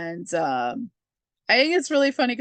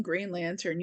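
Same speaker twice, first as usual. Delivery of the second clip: usual speaking voice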